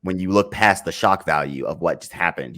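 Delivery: usual speaking voice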